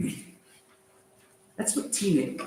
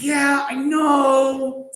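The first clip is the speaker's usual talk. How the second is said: loud, slow drawn-out insistence; hammed-up loud Yaakov pushing his demand; insistent shout; 6 semitones higher, 6 dB louder, much slower than usual. Yaakov demanding an oath from Esav, loud and drawn out.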